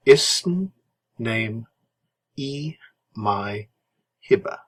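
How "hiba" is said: normal talking voice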